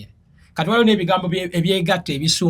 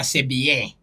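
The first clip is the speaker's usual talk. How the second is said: African accent, "bien" as "bee-yeh"; French spoken with an African accent; 3 semitones lower than usual. Shown as a demonstration. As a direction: lower African-accented take on "C'est bien". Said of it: "Naye Omufirika agamba".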